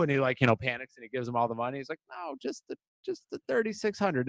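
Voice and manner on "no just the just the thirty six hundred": high, breathless panicky voice; panicked clerk. Retold or imitated. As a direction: squeak it out like a scared clerk. Panicking clerk quoted after "panics.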